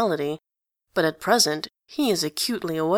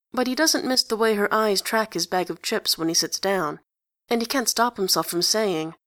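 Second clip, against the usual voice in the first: usual speaking voice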